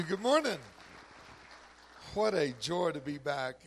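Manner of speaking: a booming, beaming hello from the pulpit; loud joyful greeting. Real, not acted. Guest preacher greeting the church, loud and joyful.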